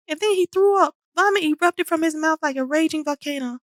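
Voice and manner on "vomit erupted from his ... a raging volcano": ordinary delivery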